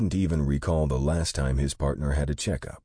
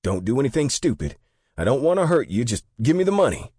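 high, loud, panicky robber yelling, faster at the end; shouting; 11 semitones higher, 6 dB louder, faster than usual. A narrator's reading. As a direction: yell at the clerk like a nervous young robber. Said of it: "the guy shouted".

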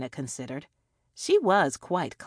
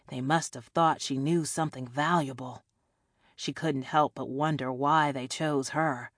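ordinary delivery, nothing marked

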